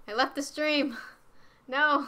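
alarmed shout. Real, not acted. Streamer realising they left the stream, alarmed. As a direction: wail it in sudden panic.

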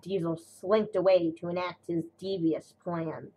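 usual speaking voice

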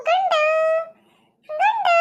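put-on rising sing-song call to the baby, pitch climbing high; baby talk cooing. (ಮಗುವಿಗೆ ಮುದ್ದಾಗಿ ಕರೆಯುವುದು)

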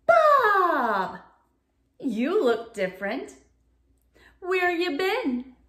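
loud high startled call; surprised cast mate. Acted. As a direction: react to a strange-looking Bob. Character greets changed Bob.

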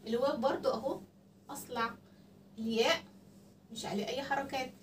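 ordinary talk, nothing marked

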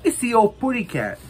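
a squeaky little canary spotting the cat; Tweety impression. Showing the Tweety figure to viewers, in a high Tweety voice. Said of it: "Tweety and Sylvester".

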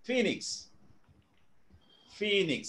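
Phoenix said as FEE-niks; correct pronunciation. Shown as a demonstration. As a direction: model Phoenix with a high first syllable, falling away. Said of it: "That's how it is pronounced".

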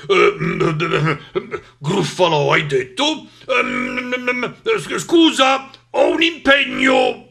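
monster's voice climbing in panic; stutter, then blurt a loud, high excuse; stammering fright. Acted. Monster makes excuses to escape, stammering in fear.

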